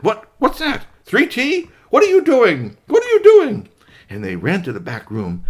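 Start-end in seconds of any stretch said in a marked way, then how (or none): 0.0-3.7 high, loud calling for her son; mother calling out in alarm; loud high alarmed mother calling Three T. Acted. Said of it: "And his mother said".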